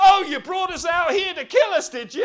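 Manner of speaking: loud, high, quick complaint; acted accusation. Preacher as Israelites blaming Moses, mock-indignant. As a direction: play an indignant crowd turning on its leader.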